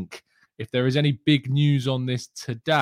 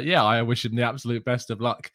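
ordinary delivery

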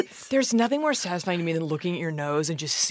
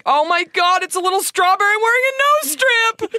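high delighted squeal over something cute; excited squeal; 12 semitones higher, 3 dB louder than usual. Real, not acted. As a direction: squeal with delight, much higher and louder. Squealing over cute strawberry mask.

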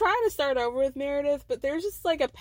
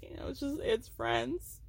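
mock weeping; 9 dB quieter, slower than usual. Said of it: "weeping now".

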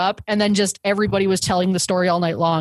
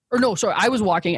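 usual speaking voice

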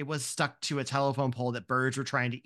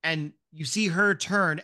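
ordinary delivery

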